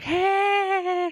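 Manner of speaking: high yelped mock scream. Mother finding daughter outside, high mock shriek.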